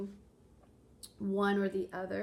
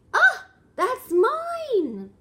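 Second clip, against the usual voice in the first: very high, loud and drawn-out frog cry; 14 dB louder, much slower than usual. Acted. Frog grabbing the egg in the picture book, shrill claim. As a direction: squeal it like a greedy little frog.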